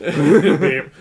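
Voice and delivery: mock censor beep. Censoring the name jokingly.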